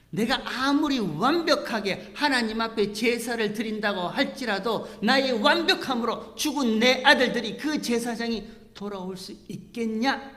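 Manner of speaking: loud, high impassioned plea; preacher voicing grieving Aaron, impassioned and loud; preaching at full force. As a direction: cry it out to the congregation. Preaching climax.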